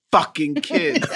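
words broken up by laughter; laughing. Real, not acted. Co-host teasing the excited host about being a kid, laughing. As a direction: say it through a laugh.

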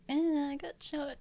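high, casual shrug of a line; nonchalant. Retold or imitated. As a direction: shrug it off lightly. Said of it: "he was just like".

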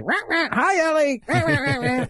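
high quacky duck voice. Retold or imitated. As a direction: quack it out like a duck talking to a baby. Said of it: "talking to myself in duck voice".

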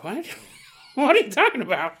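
grandma scoffing at the gnome story; incredulous grandma. Retold or imitated. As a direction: laugh it off in disbelief.

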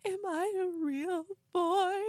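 put-on very high, drawn-out child's plea; mock crying. Robot boy pleading with aliens, in mock crying. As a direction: imitate a tearful little boy, hammed up.

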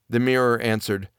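ordinary delivery

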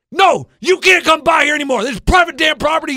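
loud, high angry yell; angry old man. Retold or imitated. Coach retelling the neighbor chasing him off, loud high yell. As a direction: bark it like a territorial old man. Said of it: "And he goes".

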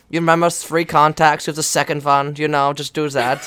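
imitated coach with a German accent. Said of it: "that's such a good accent".

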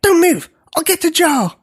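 put-on high, eager young giant; high-pitched excited order; excited command. A narrator's reading. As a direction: call it out urgently, thrilled. Excited smaller giant takes charge.

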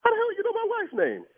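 high, baffled disbelief; stunned the stranger knows her name; shocked. Real, not acted. Prank victim hears his wife's name, shocked and high.

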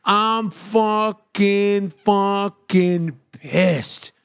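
very slow, drawn-out seething; pissed off. Retold or imitated. Patient fuming at the nurse, slow exaggerated seething. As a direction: stretch every word out with simmering anger. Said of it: "I'm fucking pissed at this point".

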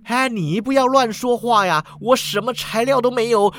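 high, hammed-up skeleton denial; flustered protest. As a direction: protest loudly and high against a false charge. Skeleton hastily denies the accusation. (骷髅慌忙高声辩白)

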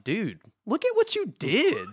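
lightly voiced Sinestro, slow and pointed; mock villain scolding. Retelling Sinestro scolding Hal, a lightly voiced slow reproach. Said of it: "Sinestro ... kind of points out".

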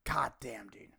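breathless whispered curse; whispered god damn. Real, not acted. Comic muttering in disbelief, whispered curse. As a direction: hiss it under your breath. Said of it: "quiet exasperated aside".

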